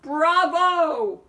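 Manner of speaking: high, loud cheering shout; shouts of bravo. A narrator's reading. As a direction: call it out like an ecstatic crowd. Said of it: "many shouts of".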